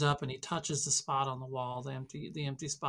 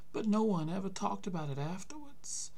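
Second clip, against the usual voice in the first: put-on higher, aged old man's voice; old grandpa voice; 5 semitones higher than usual. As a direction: voice the weary grandfather. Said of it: "he says".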